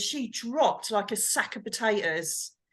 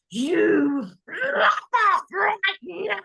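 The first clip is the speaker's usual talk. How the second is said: slow, drawn-out yell, much higher and louder; defiant shout; 9 semitones higher, 5 dB louder, much slower than usual. Retold or imitated. Standing up to the abbot's ghost, a slow furious shout. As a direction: roar it at the ghost word by word.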